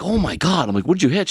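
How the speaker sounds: high, urgent panicked questions; retold panic. Boyfriend asking Jocelyn about the car, high panicked urgency. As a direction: rattle them off in a rising panic.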